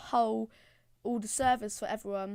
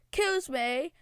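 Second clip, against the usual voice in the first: ordinary delivery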